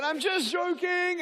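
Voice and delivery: high, drawn-out singsong; hammed-up just-kidding squeal; playful reveal. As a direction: call it out high to calm them. Pilot calls off the prank.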